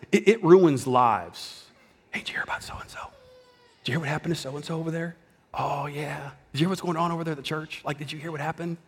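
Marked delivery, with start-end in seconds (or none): 2.1-8.8 hushed, quick mock gossip; gossipy whisper. Imitating churchgoers trading gossip about so-and-so, hushed whisper. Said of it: "if we have to whisper".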